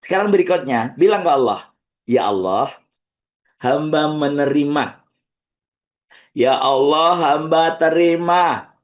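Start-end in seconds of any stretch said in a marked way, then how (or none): 2.1-8.7 slow, earnest acceptance prayer; measured accepting prayer. Acted. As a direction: lead the words of surrender.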